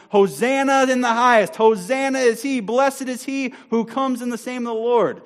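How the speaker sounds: high, full-voiced shout of praise; crowd's shout. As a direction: cry it out like a jubilant crowd. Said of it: "when the crowds are shouting".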